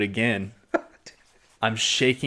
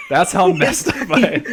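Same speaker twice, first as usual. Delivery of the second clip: laughing; 7 semitones higher, 5 dB louder than usual. Real, not acted. Laughing at own story.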